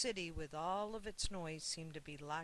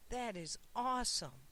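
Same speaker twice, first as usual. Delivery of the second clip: high, louder burst of wonder; boy marveling at the tall hotel, excited and louder; amazed exclaim; 5 semitones higher, 3 dB louder, slower than usual. A narrator's reading. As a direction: gasp it out like a boy seeing a skyscraper. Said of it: "Sam's eyes grew wide as he exclaimed".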